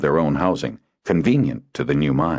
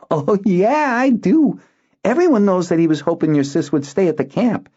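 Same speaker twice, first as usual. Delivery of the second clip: put-on high, loud knowing boy's voice; boy Zinar; 4 dB louder than usual. Boy gossiping about the sister, eager.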